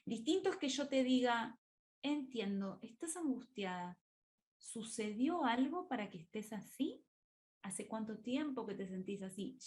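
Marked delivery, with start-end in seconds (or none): 2.0-9.6 soft, caring therapist questions; quiet, understanding voice; empathic tone. Shown as a demonstration. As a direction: gently acknowledge the feeling and then ask. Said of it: "el gesto, la mirada, el tono de voz".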